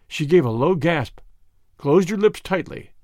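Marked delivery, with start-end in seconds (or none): none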